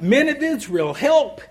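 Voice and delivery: loud, high cry for help. Retold or imitated. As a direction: call to the crowd for help, pitched well up. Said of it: "crying out".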